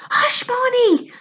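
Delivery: high, slow urgent hush; sharp hush. Acted. Lou silencing Barney up the tree, urgent hush. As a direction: shush him hard. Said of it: "Lou hissed".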